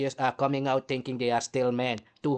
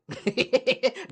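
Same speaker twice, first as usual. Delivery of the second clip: jeering laugh; 10 semitones higher than usual. Real, not acted. Laughing at his own joke.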